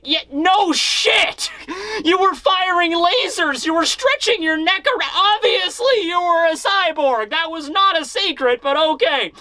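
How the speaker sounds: high, incredulous, fed-up outburst; can't believe he's saying it; exasperated. Retold or imitated. Sanji exasperated at obvious reveal.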